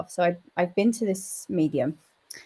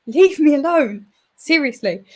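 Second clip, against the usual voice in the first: high, loud mock-annoyed demand; exasperated retort; 8 semitones higher, 8 dB louder than usual. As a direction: re-enact being thoroughly fed up. Retells sending Fred away.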